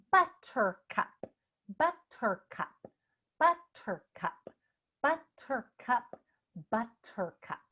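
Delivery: "Buttercup" broken into crisp, deliberate syllables; over-articulated. Shown as a demonstration. Coach leading lips, teeth and tongue drill, over-articulated "buttercup". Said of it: "really using my lips, teeth, and tongue".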